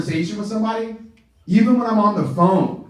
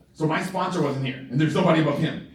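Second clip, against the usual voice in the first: normal talking voice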